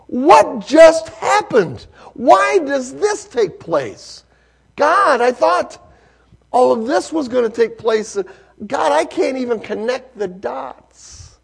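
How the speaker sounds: high, loud, slow questioning of God; impassioned lament. Acted. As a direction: plead with God, bewildered. Preacher voices believer's lament.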